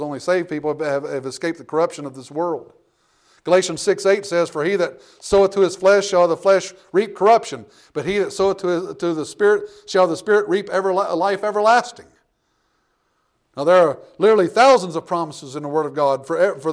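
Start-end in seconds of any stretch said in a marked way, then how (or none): 4.5-12.1 loud, measured scripture reading; preacher reading the promise aloud. Acted. Reads Galatians aloud.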